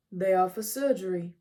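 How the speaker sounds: lightly voiced wise centaur; Chiron's voice. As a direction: speak as the calm old centaur. Chiron explains the tools.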